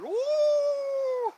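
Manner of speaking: high, loud "woo" howl; playful mock dog howl; imitated dog howl. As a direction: howl like the little dog getting into it. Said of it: "my dog would start going".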